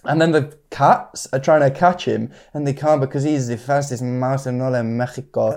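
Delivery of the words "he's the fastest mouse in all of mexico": cartoon Mexican accent; playful Mexican accent impression. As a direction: slip into a mock-Mexican cartoon voice. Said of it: "I'm not sure if that accent".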